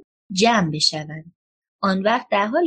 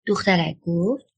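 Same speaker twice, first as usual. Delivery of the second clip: ordinary delivery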